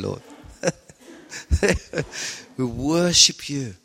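low, hushed worship prayer with long pauses. Acted. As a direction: pray gently over the congregation, drawing each word out. Leads congregation in prayer.

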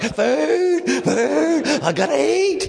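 very high, hammed-up starving wail; desperate for food. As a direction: squeal it like a mock-starving man. Acts out his starving self in queue.

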